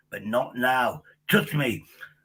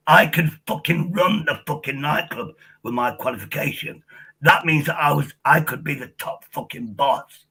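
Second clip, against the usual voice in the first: ordinary talk, nothing marked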